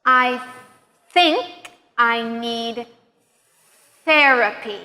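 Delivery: slow, spaced out, long voiceless TH in think and therapy; exaggerated TH. Shown as a demonstration. Teacher modeling a practice sentence, slowly exaggerating TH in think and therapy. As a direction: hold the TH starting think and therapy. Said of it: "practice some sentences with the T H sound".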